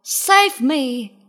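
loud plea for rescue; calling out. A narrator's reading. Lion begs the animals for rescue, loud call. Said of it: "called Lion".

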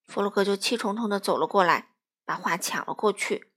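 ordinary delivery

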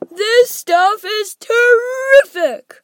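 high, loud, drawn-out delight; greedy boy relishing it; gleeful. A narrator's reading.

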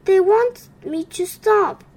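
a sad frog begging for sympathy; mild storybook wail. A narrator's reading. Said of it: "he wailed".

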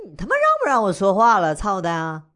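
loud, high, exasperated yell; angry outburst. Acted. Drunk client yells at the host who keeps interrupting, loud and angry. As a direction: explode at being cut off.

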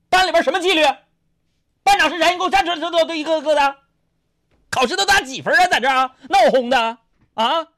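high, loud hammed-up telling-off; acted high-pitched tirade; scolding principal. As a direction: berate a whole class, pitch way up. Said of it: "一顿骂我们的".

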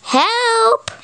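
high cried-out help; barked. A narrator's reading. Scared Floppy calling for help, high. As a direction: yelp it like a frightened dog. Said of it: "barked Floppy".

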